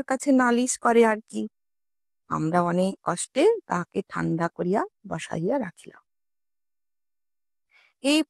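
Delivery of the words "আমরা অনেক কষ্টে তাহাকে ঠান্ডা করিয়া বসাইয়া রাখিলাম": usual speaking voice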